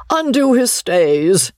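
high, drawn-out mock alarm; mocking old gentleman's call; pitiless teasing. A narrator's reading. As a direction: tease him mercilessly, pitched well up. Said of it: "continued the pitiless old gentleman".

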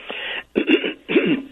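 real throat clear. Real, not acted. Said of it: "Excuse me".

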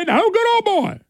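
high, hammed-up parody voice; a high parody line, mocking the candidate's slogan; mock good old boy.

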